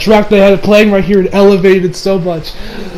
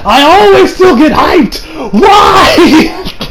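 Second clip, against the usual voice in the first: much higher, louder, slow then laughing; laughing shout; 9 semitones higher, 4 dB louder, much slower than usual. Real, not acted. Hyped fan shouting at Toriyama, high and laughing. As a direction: throw the why up in delight.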